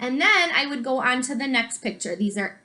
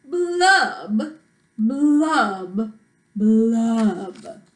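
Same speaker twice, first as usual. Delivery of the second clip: drawn-out blubs, stepping down in pitch; loud-ish, droning pouty fish refrain; glum fish blubs; 3 semitones lower, 5 dB louder, much slower than usual. Acted. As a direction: stretch each blub like a mopey fish, sinking lower each time. Pouty fish's refrain in story.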